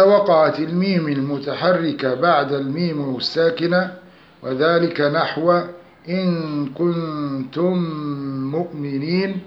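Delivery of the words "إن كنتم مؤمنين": slow, with the meems of كنتم مؤمنين merged in a held nasal hum; Quranic tajweed recitation. Shown as a demonstration. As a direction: draw out a doubled, nasal meem between كنتم and مؤمنين. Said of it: "فينطق بالميم الثانية مشددة".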